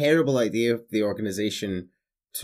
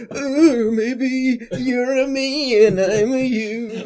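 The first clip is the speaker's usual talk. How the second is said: high, slow taunting villain; sing-song it like a smug villain; mocking villain voice; 14 semitones higher, 7 dB louder, much slower than usual. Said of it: "the whole time going".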